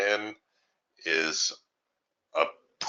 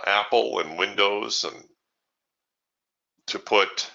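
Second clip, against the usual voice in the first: ordinary talk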